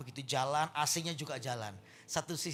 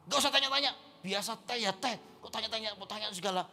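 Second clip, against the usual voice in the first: higher, snappish acted scolding; angry husband; 5 semitones higher, 4 dB louder than usual. Tired husband scolding his wife over tea, angry. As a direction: snap back crossly, starting with a loud burst.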